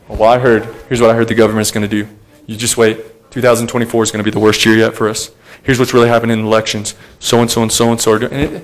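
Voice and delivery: low, quiet, hurried gossip; imitating a fretful gossip, low and quiet; worried rumour-monger. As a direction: mumble the rumour low and fast. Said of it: "Did you hear?".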